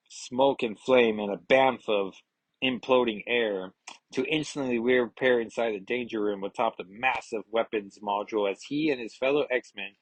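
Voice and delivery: ordinary delivery, nothing marked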